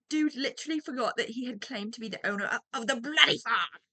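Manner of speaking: a light put-on British accent, reading the story. Shown as a demonstration. Reader switching into a mock British accent. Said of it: "Now I wanna read it in British accent".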